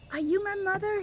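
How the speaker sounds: high, small questioning chick; baby bird voice. Acted. Baby bird asking the kitten, high and hopeful. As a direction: ask it up high like a little bird.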